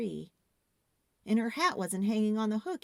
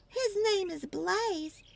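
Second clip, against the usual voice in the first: Lexus voice; 8 semitones higher than usual. A narrator's reading. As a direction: show off the pony in a higher voice. Lexus voiced, much higher.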